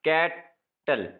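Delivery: "Cattle" slowly in two separate beats; syllable by syllable. Shown as a demonstration. Teacher dividing words for exercise, "cattle" slowly split in two. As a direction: pause between कैट and टल in "cattle". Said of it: "दो syllable".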